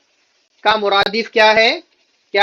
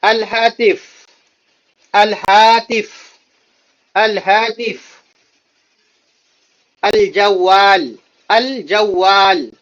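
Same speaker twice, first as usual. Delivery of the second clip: slow, drawn-out الہاتف and الجوال; teacher drilling new vocabulary; slow drill reading; slower than usual. Shown as a demonstration. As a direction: hold each syllable of الجوال for repetition. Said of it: "vocabulary drill repeated".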